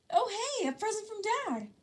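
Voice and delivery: high, bright-eyed delight over a parcel; excited. A narrator's reading. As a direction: gush like a happy kid. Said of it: "Midoriya grinned".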